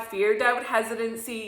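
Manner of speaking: ordinary delivery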